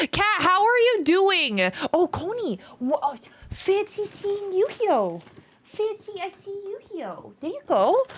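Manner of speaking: high cooing pet voice. Real, not acted. Greeting the dog Coney in sweet baby talk.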